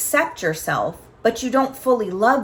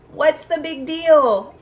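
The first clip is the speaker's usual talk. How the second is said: slow, high, louder mock of her own dismissive line; dismissive question; 5 semitones higher, 4 dB louder, slower than usual. Mother replaying her dismissive reply to her son, slow and pointed. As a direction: drag out the big deal like it's nothing. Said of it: "I literally said the words".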